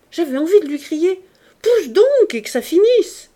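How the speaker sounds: rising to a high mocking shout; loud mockery. A narrator's reading. Hippolyte mocking a woman's labour, loud. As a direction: blurt the cruel line out loud.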